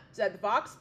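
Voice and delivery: plain reading voice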